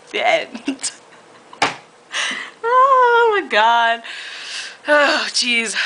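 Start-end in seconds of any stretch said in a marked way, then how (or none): 2.7-5.8 exclaiming. Real, not acted. Reader stunned at what she just read, exclaiming. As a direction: stretch it out in shock.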